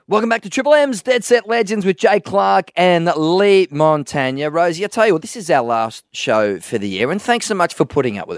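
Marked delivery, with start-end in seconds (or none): 0.1-4.5 radio announcer. Acted. Announcer intro, big voice.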